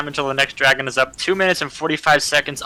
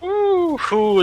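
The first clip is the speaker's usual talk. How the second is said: surprised ooh. Real, not acted. Co-caster reacts to the push.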